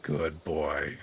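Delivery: put-on low, soft good boy; soft pet praise. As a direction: coo it gently to a pet.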